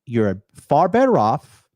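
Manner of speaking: usual speaking voice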